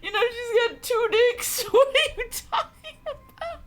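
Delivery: high, loud laughing speech; laughing hard. Real, not acted. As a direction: talk while cracking up. Cracking up at the text.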